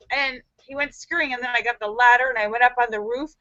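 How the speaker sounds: ordinary delivery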